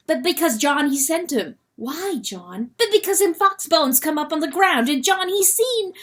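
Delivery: high, loud, broken-English weasel; a fretful creature blurting out bad news; agitated weasel John. Weasel John warning Lady Hen, high and loud.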